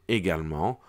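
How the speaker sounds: ordinary talk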